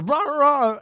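loud, high nonsense babble; mock slurred gibberish. Tripping dancers think they are talking, mock slurred gibberish. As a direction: imitate someone babbling in a made-up language. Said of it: "slurring, mumbling words".

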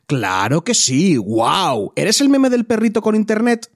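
leído con sarcasmo exagerado, empezando lento; acalorado burlón. Acted. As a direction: lee el comentario como una réplica burlona, subiendo mucho al final. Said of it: "igual está todavía con la calentura". (réplica acalorada y burlona)

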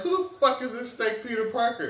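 high, loud disbelieving yell; hammed-up shocked shout; shocked outburst. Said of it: "I was like".

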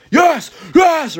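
very high shouted yes yes; shouted excited yes. Retold or imitated. Mom reading to her son, high shouted cheer. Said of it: "reading this to my son being like".

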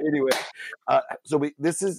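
high, giggly talk as he moves on; laughing. Real, not acted. Host recovering from his own joke, still laughing. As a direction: still chuckling while steering back.

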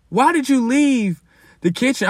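high, exasperated question to the roommate. Retold or imitated. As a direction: squeal the why in disbelief.

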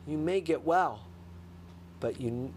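ordinary talk

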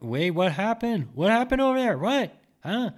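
high, loud, alarmed calling out; yelling from the kitchen. Retold or imitated. Relatives call from the kitchen in alarm, loud and high. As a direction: shout across the house to find out what's going on. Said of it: "they're already yelling".

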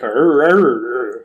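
slow, drawn-out West Country pirate arrs; mock West Country arr. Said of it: "West Country accent sounds very much like they're just going".

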